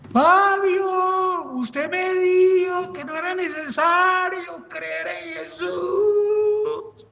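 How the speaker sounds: high, desperate shouting, breaking into a cry; a doomed soul crying out to a friend; anguished cry. Acted. Alma cayendo grita al amigo. (alma que cae grita a Fabio, desesperada y llorosa)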